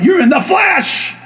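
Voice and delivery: high-pitched scolding shout; acted accusing cry; shouted rebuke. The Lord acted out scolding him.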